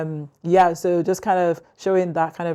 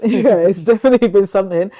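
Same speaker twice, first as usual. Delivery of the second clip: laughing; 3 semitones higher, 9 dB louder than usual. Real, not acted. Laughing while answering.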